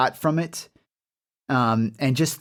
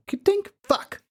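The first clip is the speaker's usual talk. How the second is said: high, tiny voice mimicking a lock and a curse; comic high-pitched lockpicking fumble; failed lockpicker. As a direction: imitate a click, then a squeaky little curse. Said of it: "it's messing with it again".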